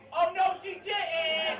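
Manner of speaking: high, slow shouted gasp; talk show audience. Retold or imitated. Comic reacting to the heckle like a Maury audience, shout. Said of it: "like an audience member on Maury Povich".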